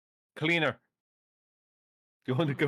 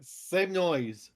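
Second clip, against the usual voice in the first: normal talking voice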